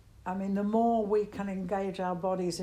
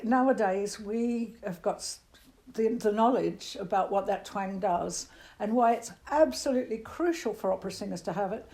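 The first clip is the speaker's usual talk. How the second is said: ordinary talk, nothing marked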